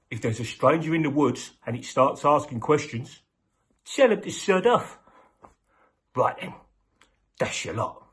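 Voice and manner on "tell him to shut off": ordinary delivery, nothing marked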